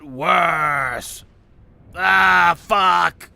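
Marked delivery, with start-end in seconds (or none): 2.0-3.3 loud, sharp outburst of frustration; a quick, loud "fuck" shouted at the game; sudden curse. Real, not acted. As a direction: blurt it out loud like you just got hurt. Sudden outburst mid-rant.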